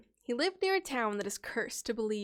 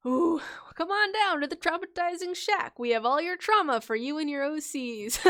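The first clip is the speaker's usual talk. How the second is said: a hint of a cheery barker, higher; mock shopkeeper; 5 semitones higher, 4 dB louder than usual. Streamer jokes to chat like a shop barker, lightly voiced. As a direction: pitch the trauma shack like a salesman.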